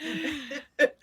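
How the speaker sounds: breaking into real laughter mid-word; squeezed out between laughs; laughing. Real, not acted. Laughing hard at the punchline.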